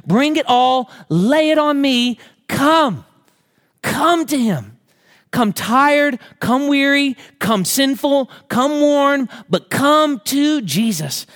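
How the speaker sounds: preacher's rising invitation to come to Jesus; impassioned altar call. Acted. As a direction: plead with the congregation to come.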